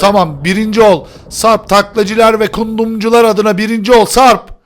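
loud, high rallying call; rousing cheer. Real, not acted. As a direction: urge a teammate on at full voice. Düşen oyuncu arkadaşını gaza getiriyor. (coşkuyla, bağırarak gaz verme)

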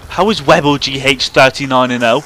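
can't believe the score; loud disbelief. Real, not acted. Gamer baffled by an unbeaten enemy player, loud disbelief.

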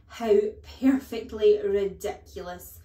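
very loud scornful snort; snorted contempt. A narrator's reading. Headmistress dismissing the spelling song, snorting with scorn. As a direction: explode with disdain. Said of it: "snorted the Trunchbull".

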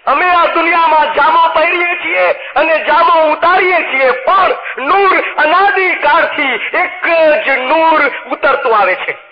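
high, slow, solemn proclamation; preacher voicing the Imam's farman, high and drawn out. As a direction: intone it slowly, like a holy decree.

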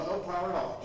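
usual speaking voice

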